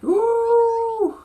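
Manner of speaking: high loud excited squeal. Real, not acted. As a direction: squeal with delight. Big reaction to episode.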